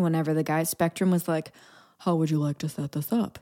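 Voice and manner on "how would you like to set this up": mock installer voice. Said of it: "He didn't actually talk like that".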